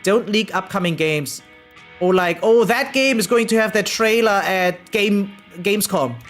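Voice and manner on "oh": normal talking voice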